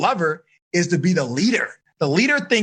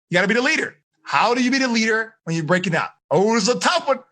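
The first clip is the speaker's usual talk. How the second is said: ordinary delivery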